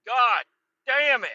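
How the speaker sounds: high, loud curse after the yell; real exasperated curse; angry curse. Real, not acted. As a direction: spit it out, still furious. Still cursing the loud motorcycle.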